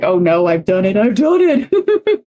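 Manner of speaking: mock dismay. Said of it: "And it's like".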